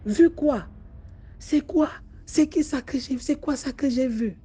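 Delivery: high, frantic questioning; panicked questions. Acted. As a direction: demand answers, shaken and scared. Woman panics at strange sight. (questions affolées, voix aiguë)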